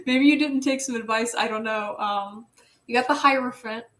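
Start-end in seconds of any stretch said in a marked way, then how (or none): none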